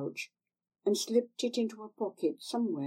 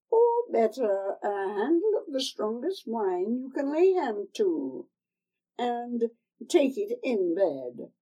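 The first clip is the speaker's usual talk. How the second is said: put-on higher, wheedling old hag voice; old crone; 5 semitones higher, 4 dB louder than usual. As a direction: coax her like a sly old crone. Old woman gives instructions.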